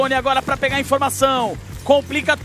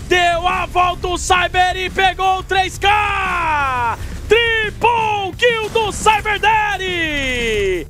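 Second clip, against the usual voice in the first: fighting game announcer; 3 semitones higher, slower than usual. Real, not acted. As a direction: build to a triple kill scream. Triple kill hype call.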